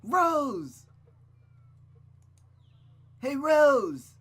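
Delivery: loud, high shout with a drawn-out name; calling out. Acted. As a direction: holler to get her attention from afar. Boy calls out to girl.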